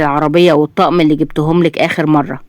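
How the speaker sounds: usual speaking voice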